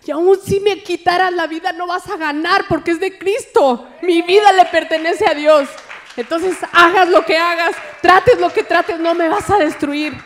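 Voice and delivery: loud, high defiant declaration to the devil; full-force preaching. Acted.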